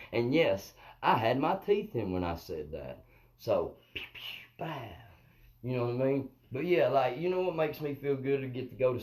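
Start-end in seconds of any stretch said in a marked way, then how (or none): 3.9-4.9 whispered pew-pew sound effects; breath out playful little gunshots; imitated shooting noises. Speaker caps his cold-weather joke, whispered shot noises. Imitates shots after a punchline.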